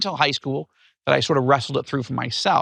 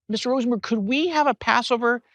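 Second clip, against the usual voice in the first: put-on higher, eager child asking; little girl voice; 8 semitones higher than usual. Girl asks teacher for a Passover Seder, higher eager child voice. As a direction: pitch up as a hopeful schoolgirl. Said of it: "then she said".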